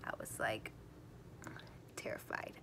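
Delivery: very soft, whispered confession of fear; hushed dread. Real, not acted. Daughter recalling waiting for her mom, in a hushed whisper. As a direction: drop to a low, near-voiceless whisper.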